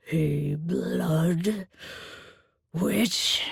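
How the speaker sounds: low, demanding beast voice; demanding Minotaur. A narrator's reading. Minotaur demanding whether she is a blood witch, low. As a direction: press the question like a suspicious monster. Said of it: "he demanded".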